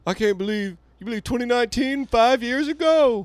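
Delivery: very high, mock-astonished whine; mocking incredulous voice. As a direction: squeal the disbelief like a parody. Mocking people again.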